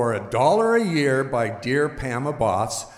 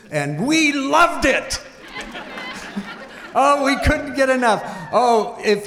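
laughing with joy; 10 semitones higher than usual. Real, not acted. Joyful memory of theater days.

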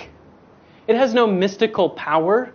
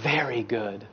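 breathless wonder; 8 semitones lower, 7 dB quieter than usual. Acted. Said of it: "he gasps and he says".

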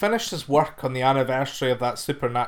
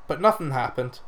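ordinary delivery, nothing marked

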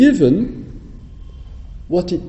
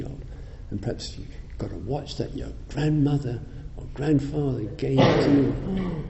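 ordinary delivery

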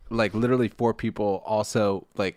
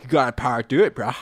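frat bro; 2 semitones higher, 4 dB louder than usual. Retold or imitated. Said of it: "everyone was just like".

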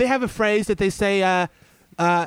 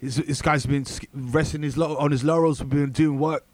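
mock London roadman; 6 semitones lower than usual.

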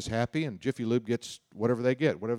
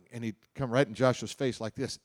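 ordinary talk, nothing marked

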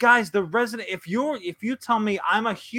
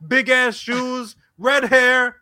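high, louder punchy listing; emphatic clown description; 5 semitones higher, 5 dB louder, slower than usual. Acted. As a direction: bellow out each clown feature.

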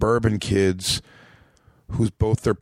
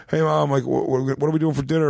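higher-pitched casual call to mom; breezy retold chat, raised pitch; casual teen self; 5 semitones higher than usual. Teen asking mom about dinner, casually acted out.

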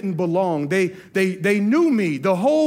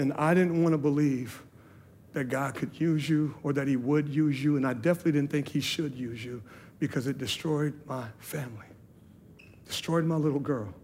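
hushed, low, sorrowful retelling; subdued, halting toward the painful part; retold sobbing woman; 5 semitones lower, 7 dB quieter than usual. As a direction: voice her hurt quietly, without tears. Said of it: "She's just sobbing".

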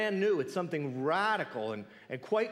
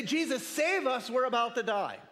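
higher, pleading cry for help; a higher panicked plea in the storm; mock panicked plea; 8 semitones higher, 3 dB louder than usual. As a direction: play the frightened disciples begging for rescue. Disciples' imagined panicked plea.

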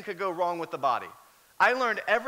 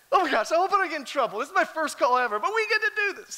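fast, higher, louder nervous thrill; giddy excitement; 8 semitones higher, 5 dB louder, faster than usual. Retold or imitated. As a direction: rattle it off like a thrilled newbie. Rookie's excited inner thoughts.

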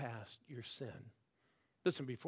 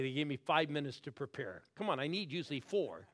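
normal talking voice

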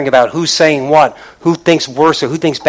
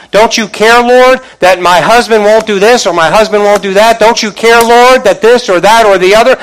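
high, loud pleading complaint; exasperated pleading to the Lord; frustrated plea; 7 semitones higher, 8 dB louder than usual. Retold or imitated. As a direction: cry out to the Lord, fed up. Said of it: "Martha gets all frustrated".

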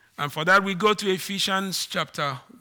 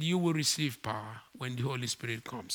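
softer and lower, gently repeating; Jesus's words again to his disciples, said quietly and gently; quiet restatement; 5 semitones lower, 7 dB quieter than usual. Acted. Preacher repeating the promise quietly, softer and lower. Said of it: "he said".

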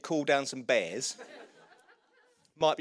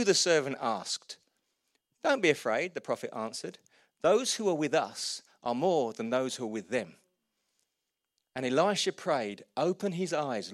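reads the passage with raised pitch and energy; scripture reading; 3 semitones higher, 3 dB louder than usual. Acted. Preacher reading Second Kings to the church, storytelling. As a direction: tell the Bible story aloud to the room.

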